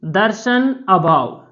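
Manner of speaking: दर्शन अभाव drawn out slowly; dictating दर्शन अभाव slowly; slow repeat. Shown as a demonstration. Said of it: "पहला होता है दर्शन अभाव।".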